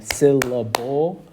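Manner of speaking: SYLLABLE slow, split into three even beats. Shown as a demonstration. Teacher showing students how to clap out syllables, syllable by syllable. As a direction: say syllable beat by beat, as if clapping. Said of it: "clap your hands together for the syllable count".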